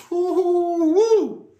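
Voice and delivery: high, slow hooting woo-hoo-woo; excited hoot. Real, not acted. Reading scripture aloud, hooting in delight at a line. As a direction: let out a long thrilled hoot.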